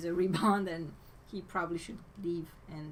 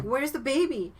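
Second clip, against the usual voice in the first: raised, louder imitation of alarm; alarmed group question; 6 semitones higher, 7 dB louder than usual. Voicing everybody at the party asking after the baby, raised alarm. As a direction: call it out as the worried crowd, mildly hammed-up. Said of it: "everybody was like".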